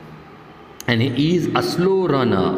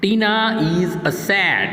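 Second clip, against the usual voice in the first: slow dictation; 4 semitones higher, much slower than usual. Shown as a demonstration. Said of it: "reads sentence slowly".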